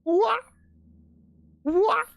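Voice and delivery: very high squeak; shrieking what. Real, not acted. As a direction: squeak it in disbelief. Shrieking at trailer.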